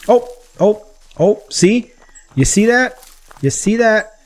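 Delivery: high-pitched, startled exclamations; excited surprise. Real, not acted. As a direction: blurt it out high as the quarry suddenly appears. Boar suddenly appears as he speaks.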